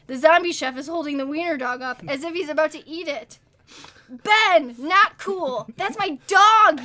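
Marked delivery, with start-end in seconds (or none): 4.1-6.8 loud, high, slowing angry yell. Acted. Vic yelling at the zombie chef holding his dog, loud. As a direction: shout him down to save the dog. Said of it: "yells Vic".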